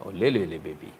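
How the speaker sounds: a hint of mocking baby talk. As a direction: soothe them like a toddler, sarcastically. Mock cooing at scared opponents.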